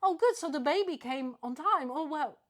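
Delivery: bright, loud happy congrats; cheerful congratulating. Retold or imitated. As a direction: gush as if the baby came. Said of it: "I was like".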